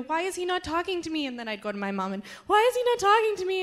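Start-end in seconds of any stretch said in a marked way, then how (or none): 0.0-1.3 higher, softer frustrated whine; retold plaintive question, pitched up; retold complaint. Believer complaining that God is silent, plaintive. 2.5-3.6 high, quick whiny complaint; mock-whine it, pitched way up and fast; retold whining. Grown child complaining to mom, exaggerated whining.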